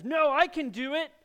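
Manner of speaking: put-on high, loud whiny kid's protest; pouting child. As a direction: pout it out like a stubborn little boy. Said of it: "The child pouts and says".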